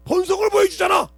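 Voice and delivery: 설교하듯 목청을 높여 외치듯; 회중을 꾸짖으며 크게 외치는 설교; preaching at full force. Acted. (큰 소리로 꾸짖는 설교)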